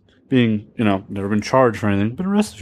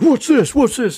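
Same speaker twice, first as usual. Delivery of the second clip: very high, loud, mock-frantic questioning; alarmed cop demand; 13 semitones higher, 5 dB louder than usual. Cop finding the farm boy's knife, shrieky mock alarm. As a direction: imitate an overexcited cop pouncing on evidence.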